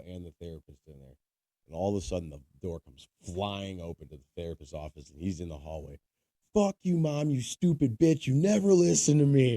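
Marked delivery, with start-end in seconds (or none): 6.5-9.6 loud, high furious yelling at his mom; angry yell. Retold or imitated. A grown son yelling at his mom in the hallway, furious shout. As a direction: scream it at your mother in rage.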